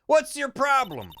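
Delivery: high, slow Southern indignation; a Southern journalist's high-pitched challenge; Southern accent. Acted. Journalist to a detective blocking her way, indignant Southern accent. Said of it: "A journalist with a southern accent".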